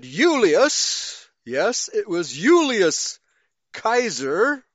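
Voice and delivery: slow, emphatic "Julius" with a Y, "Kaiser" with a hard K; Latin pronunciation. Shown as a demonstration. Teacher demonstrating the old Latin name, "Julius" starting on Y, slow and emphatic. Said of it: "sounded similar to English Y".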